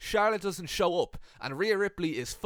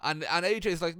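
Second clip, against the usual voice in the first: ordinary talk, nothing marked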